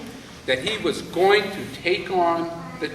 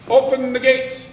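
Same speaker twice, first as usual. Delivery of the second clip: royal command; 6 semitones higher, 6 dB louder than usual. Acted. King gives the order.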